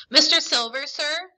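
tentative, polite query; hesitant. A narrator's reading. Boy addressing the tavern landlord, hesitant. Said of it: "I asked hesitantly".

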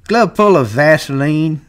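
scornful, revolted taunt; disgusted. A narrator's reading. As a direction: sneer it with distaste. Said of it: "he said disgustedly".

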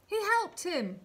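high, loud call from the crowd; excited guess called out loudly; eager shout. A narrator's reading. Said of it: "shouted someone in the crowd".

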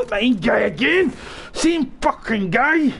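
high, loud, drawn-out disbelief; exasperated outcry. Real, not acted. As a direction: wail at being killed again. Killed by same player again.

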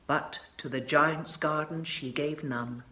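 ordinary delivery, nothing marked

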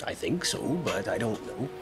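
usual speaking voice

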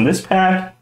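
ordinary talk, nothing marked

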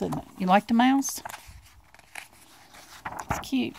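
plain reading voice

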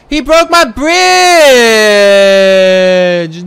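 high, loud drawn-out yell; outraged scream. Real, not acted. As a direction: scream in outrage, stretching the key word. Enemy destroys the player's bridge.

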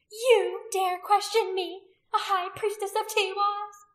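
high, slow, loud indignation; outraged priestess. A narrator's reading. High priestess challenged by a warrior, loudly outraged. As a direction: draw out the challenge, high and imperious. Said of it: "Anomia cried out at him, eyes blazing".

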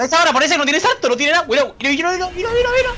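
rising urgent callout, pitch climbing; excited callout. Real, not acted. Teammate calling out jump in fight.

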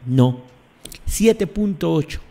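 ordinary delivery

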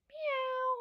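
high mewing cat sound; playful high cat meows. Shown as a demonstration. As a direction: meow like a kitty for the kids. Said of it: "Let's make it all together".

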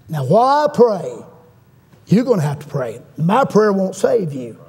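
ordinary delivery

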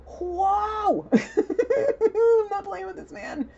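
high, loud whoop breaking into laughter; real startled laughter. Real, not acted. Reader reacting to this scary man, real high laughing. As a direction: laugh hard through the words, pitch flying up.

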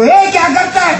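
high-pitched indignant shout; mock yell. Man behind yells at a passenger scratching him, mock yell. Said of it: "मागचा ओरडतो".

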